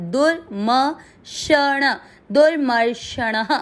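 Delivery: दुर्मर्षणः slow, म and ष fully voiced; careful syllable demo. Shown as a demonstration. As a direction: spell out दुर्मर्षणः slowly, no syllable swallowed. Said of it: "इन दोनों को पूरा पढ़ना है".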